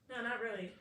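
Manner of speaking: low, quiet, matter-of-fact refusal; unbothered monotone. Shown as a demonstration. Answering "did you miss me today", unbothered monotone. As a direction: answer softly with no enthusiasm. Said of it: "I naturally talk in a monotone".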